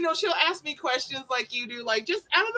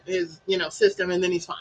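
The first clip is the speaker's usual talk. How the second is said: ordinary talk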